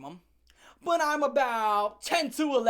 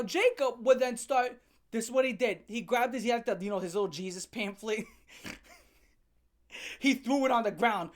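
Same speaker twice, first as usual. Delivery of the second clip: ordinary talk, nothing marked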